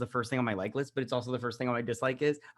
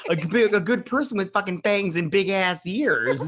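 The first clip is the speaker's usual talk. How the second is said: mocking loud rant; 6 semitones higher, 7 dB louder than usual. Ranting about the vampire's looks.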